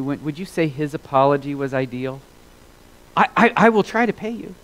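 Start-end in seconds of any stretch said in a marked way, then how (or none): 3.1-4.5 stammering servant plea. Retold or imitated.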